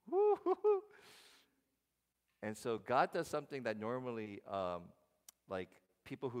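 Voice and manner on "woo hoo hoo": high playful woo-hoo-hoo; falsetto hoot. Real, not acted. Pastor mock-cheering God's comeback, high hoot.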